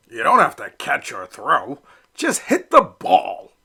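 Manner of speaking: a dad urging his son on; coaching Papa Duck. Acted.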